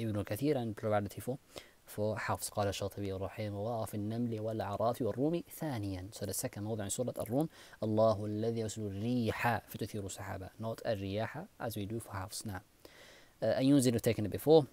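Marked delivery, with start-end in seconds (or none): none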